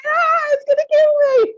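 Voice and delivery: high, loud mock shriek of terror; terrified scream. Telling the badger story, a high hammed-up terrified shriek. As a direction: shriek like the badger will kill you. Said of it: "I'm going".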